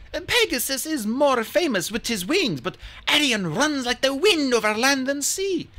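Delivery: a queen showing off her prize; the queen boasting to Hazel about Arion; proud Amazon queen. Acted.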